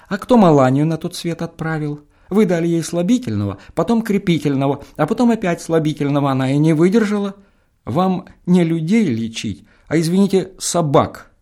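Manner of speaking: a son throwing blame at the feldsher; accusing son. Acted. Сын обвиняет фельдшера. (возмущённое обвинение, выше и громче)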